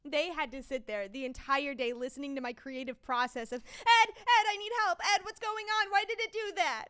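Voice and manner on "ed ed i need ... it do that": high, loud cries for help; hammed-up helpless panic, calling a colleague over; mock panicked calling. Retold calls to Ed for help.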